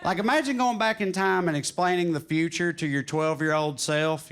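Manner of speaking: normal talking voice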